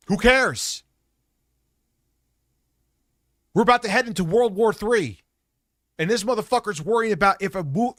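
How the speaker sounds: high, loud tirade of contempt; real, sustained outraged shouting; angry shouted rant. Real, not acted. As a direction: yell every phrase in furious exasperation. Angry rant insulting the reviewer.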